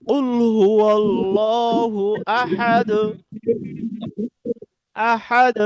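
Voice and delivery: slow chanted recitation; Quran recitation. Shown as a demonstration. Teacher reciting a verse slowly to show qalqala. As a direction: draw out কুল হুয়াল্লাহু Ahad in tarteel. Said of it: "এই কলকলা করে পড়তে হবে".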